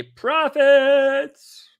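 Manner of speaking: high, loud, stretched-out "profits"; gleeful trade brag. Real, not acted. Trader showing off his winning trade, gleeful brag. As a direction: crow it, drawing out the last word.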